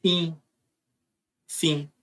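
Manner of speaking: "fim" said with a nasal i, no m sound. Shown as a demonstration. Teacher shows students how "fim" sounds, nasal i. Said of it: "essa palavra aqui é".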